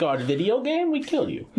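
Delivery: hammed-up Russian villain accent, pitch climbing high; a bad joke Russian accent for the video game; mock Russian accent. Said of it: "I don't know what accent that was".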